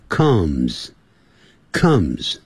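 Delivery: slow, low "comes" with an "uh" vowel; correct vowel in comes. Shown as a demonstration. Teacher modelling the word for students, "comes" with a short "uh". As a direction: model "comes" slowly, rhyming with "sums". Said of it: "不要念成comes".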